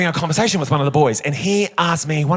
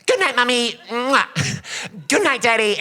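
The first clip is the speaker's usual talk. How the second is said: put-on higher, childlike goodnight with kisses; sweet little boy; 6 semitones higher than usual. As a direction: say it like a small boy blowing kisses. Retold goodnight at twelve.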